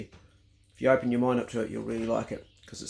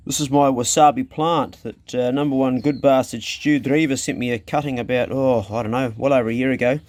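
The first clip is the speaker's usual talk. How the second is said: ordinary delivery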